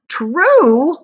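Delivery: high incredulous cry; astonished cry. Acted. Grandparents crying out to Charlie in disbelief. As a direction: burst out in disbelief. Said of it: "they all cried at once".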